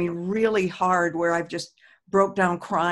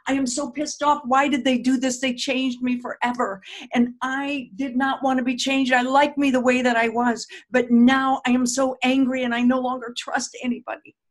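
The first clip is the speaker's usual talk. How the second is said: higher and louder, venting anger; mock angry tapping script; 4 semitones higher, 3 dB louder than usual. Shown as a demonstration. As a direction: voice the anger as you tap. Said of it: "sometimes I will just be pissed off".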